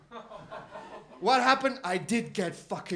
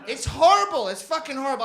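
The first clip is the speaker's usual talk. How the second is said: normal talking voice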